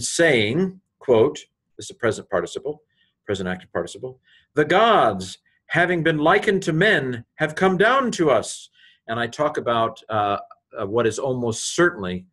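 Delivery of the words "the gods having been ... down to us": higher, louder excited cry; crowd crying out. Retold or imitated. The Lycaonian crowd shouts about the gods, a raised excited cry. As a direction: call it out as the awed Lycaonian crowd. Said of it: "they raised up the voice of them".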